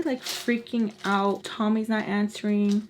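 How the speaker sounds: usual speaking voice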